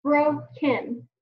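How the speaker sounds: "Broken" in two beats, bro, ken; slow, separated "Broken": bro ... ken; split into syllables. Shown as a demonstration.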